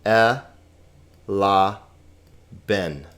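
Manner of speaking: kat, e, la, ben with long gaps; separated syllables, falling to "ben". Shown as a demonstration. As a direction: count out kat - e - la - ben slowly. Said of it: "four vowels".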